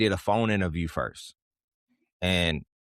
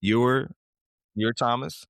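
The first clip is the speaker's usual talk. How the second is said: retold surprise; 4 semitones higher, slower than usual. Retold interviewers' surprise at uncle.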